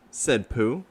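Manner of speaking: plain reading voice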